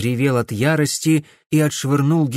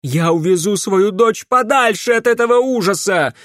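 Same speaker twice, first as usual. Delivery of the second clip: high, loud, defiant cry; desperate shout; 8 semitones higher, 5 dB louder than usual. A narrator's reading. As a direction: cry out a mother's vow. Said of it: "крикнула Генриетта". (отчаянный крик матери)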